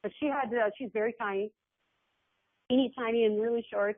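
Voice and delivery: usual speaking voice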